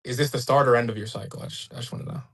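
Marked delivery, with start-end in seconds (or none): 1.4-2.2 mock-innocent whisper. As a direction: breathe it out like a secret. Teasing question about cycle.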